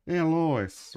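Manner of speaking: loud, high put-on cartoon dad call; Peter Griffin. Trying the cartoon dad again, loud and high through a laugh. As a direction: belt it out as a goofy sitcom dad. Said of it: "I can't do a Peter Griffin, uh, voice".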